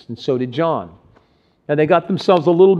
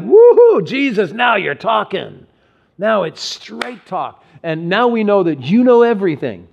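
high, bright woohoo then eager praise; excited disciples; 5 semitones higher, 4 dB louder than usual. Retold or imitated. Preacher voicing disciples cheering Jesus, excited. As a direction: cheer like thrilled followers. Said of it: "This excited the brothers".

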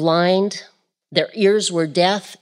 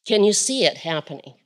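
normal talking voice

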